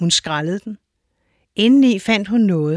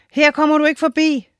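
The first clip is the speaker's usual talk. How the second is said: high, defiant storybook voice; bamboo forest voice; 8 semitones higher than usual. A narrator's reading. Bamboo forest stopping the giant, high defiant voice. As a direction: block the giant in a high, proud voice. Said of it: "sagde bambusskoven".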